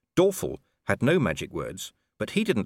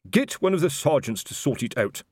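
higher, a touch testy brush-off; lightly voiced irritable captain; 7 semitones higher than usual. A narrator's reading. Colon brushing off Constable Shu, a touch testy. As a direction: wave the constable away impatiently.

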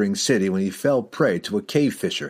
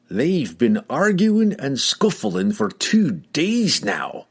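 gruff dwarf, slow and disgusted; a fed-up old dwarf grumbling; 8 semitones higher, much slower than usual. A narrator's reading. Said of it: "Bruenor remarked disgustedly".

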